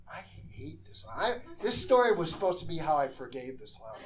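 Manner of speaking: ordinary talk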